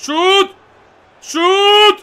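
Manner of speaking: very high, insistent cry; urgent shout. Real, not acted. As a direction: yell twice for the shot. Urging a player to shoot. (επιτακτική τσιριχτή κραυγή)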